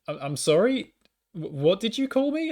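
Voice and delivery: high-pitched, stammering disbelief; taken aback. Retold or imitated. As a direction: question an insult in mock bewilderment. His taken-aback reply to the heckler.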